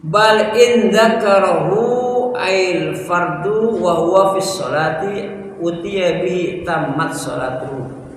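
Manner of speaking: loud, high Arabic reading; kitab recitation. Acted. Teacher reads the Arabic book text aloud to class.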